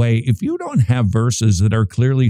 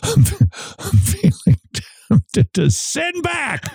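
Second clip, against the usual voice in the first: high-pitched, laughing through the words; helpless laughing; 8 semitones higher, slower than usual. Real, not acted. Host teased by co-host about being homesick, laughing hard. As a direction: crack up while trying to get the denial out.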